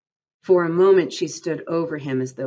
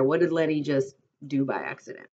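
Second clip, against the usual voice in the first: ordinary delivery, nothing marked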